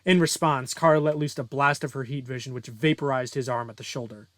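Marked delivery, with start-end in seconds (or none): none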